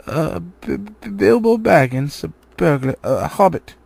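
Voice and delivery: frightened stutter on the name; stammering in fear. A narrator's reading. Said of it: "Bilbo, shaking all over".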